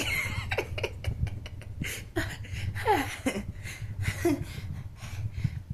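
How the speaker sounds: long breathy, wheezing laugh; long laughter. Acted. Spirit delighted to be back, long breathy laughter. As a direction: laugh until out of air. Channelled Whitney laughs after greeting.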